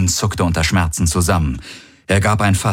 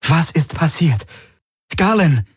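insistent, anxious woman's voice, higher; demanding and worried; 9 semitones higher than usual. Acted. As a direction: demand an answer, full of worry. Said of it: "Ihre Stimme war fordernd und besorgt". (fordernd und besorgt)

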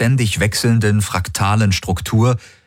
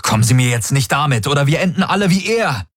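quick, agitated warning rising higher; heated retort; 6 semitones higher, faster than usual. A narrator's reading. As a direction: fire back fast and urgently. (hitzig, schnell, immer höher)

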